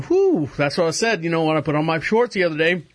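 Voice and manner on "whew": high, sharp whistle of shock at the scale; shocked whew. Retold or imitated. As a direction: blow out a stunned whew. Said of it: "So I was like going".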